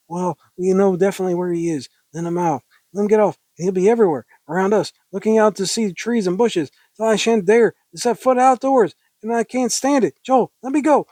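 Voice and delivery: high, insistent, worked-up dialogue; hysterical woman. Acted. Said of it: "I like being kinda hysterical".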